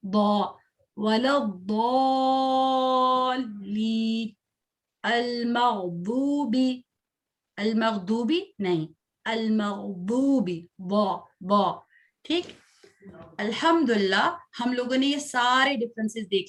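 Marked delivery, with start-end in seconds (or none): 1.0-4.3 slow वला द्दाल्लीन, mouth filled with air on the heavy द्द; heavy daad. Shown as a demonstration. Teacher showing the heavy daad slowly. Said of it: "ऐसे जैसे मुंह में हवा भर जाती है". 10.8-11.8 बॉ, बॉ drawn out with a full, puffed mouth; heavy daad sound. Shown as a demonstration. Teacher isolating the heavy letter sound. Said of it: "ऐसे जैसे मुंह में हवा भर जाती है".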